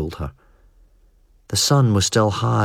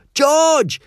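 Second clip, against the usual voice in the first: high, loud scream of his name; 4 dB louder than usual. Acted. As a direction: scream it in panic. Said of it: "Hugo screamed".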